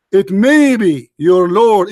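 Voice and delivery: "may" stretched and stressed; slow emphatic quote. Real, not acted. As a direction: drag out "It may be" slowly. Quoting the verse with emphasis.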